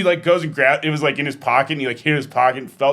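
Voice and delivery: ordinary talk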